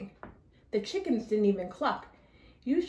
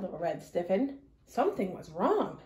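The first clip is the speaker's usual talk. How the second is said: ordinary delivery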